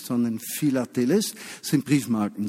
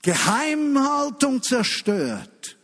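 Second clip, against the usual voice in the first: high, drawn-out declaration; emphatic proclamation; 12 semitones higher, 3 dB louder, much slower than usual. Real, not acted. Preacher warning the congregation about secrecy, emphatic and drawn out. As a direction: proclaim it high and slow, stretching each word.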